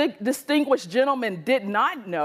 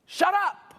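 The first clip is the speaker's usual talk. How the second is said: higher, sharp rebuke; stern shut up; 9 semitones higher than usual. Retold or imitated. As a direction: silence the child. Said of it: "and he said".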